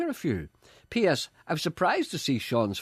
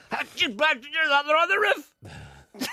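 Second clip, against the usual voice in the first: high-pitched comic yell, drawn out; mock rooftop shout; 4 dB louder than usual. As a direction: call up to someone on a roof in mock alarm. Acts calling up to rooftop.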